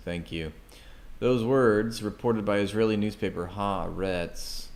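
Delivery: ordinary talk, nothing marked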